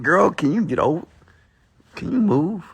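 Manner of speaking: high-pitched request to a partner; high, pleading "move over"; playful nagging. Real, not acted. Telling girlfriend to move over.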